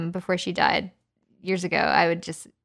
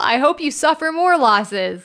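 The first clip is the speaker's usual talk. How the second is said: mock gushing; 7 semitones higher, 5 dB louder than usual. Mock congratulation.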